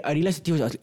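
ordinary delivery

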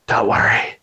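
low, hushed reassurance; talking steering wheel. Retold or imitated. Storyteller as the steering wheel calming him down, low hushed voice. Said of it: "the steering wheel just goes".

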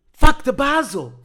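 high, loud cry of dismay; panicked shout. Retold or imitated. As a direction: yell out a sudden awful realisation. Acted panicked realisation as doors close.

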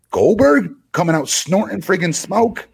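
high-pitched, exasperated disbelief; real exasperation, voice jumping up high; incredulous outburst. Real, not acted. Incredulous comparison of the two wrestlers.

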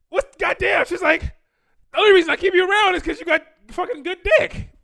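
high, loud imitation of a frustrated woman; frustrated outburst. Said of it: "acted like she was getting frustrated".

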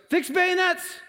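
very high, strained battle yell; screamed command. Retold or imitated. Commander orders his men to charge, screaming. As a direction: scream the order across the line. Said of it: "you hear the screams coming".

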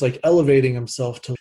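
usual speaking voice

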